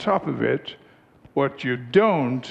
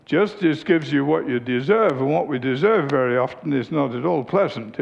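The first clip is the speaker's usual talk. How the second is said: normal talking voice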